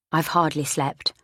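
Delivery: usual speaking voice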